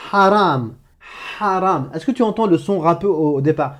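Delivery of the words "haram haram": "haram" starting on a rasping throat breath; Arabic ḥ sound. Shown as a demonstration. Teacher repeating "Haram" with a scraped throat h. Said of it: "Comme dans".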